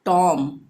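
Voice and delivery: टॉम ending on m, no b; teacher's clear टॉम with a silent B. Shown as a demonstration. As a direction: leave the final b off टॉम. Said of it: "तो बी को silent रखेंगे".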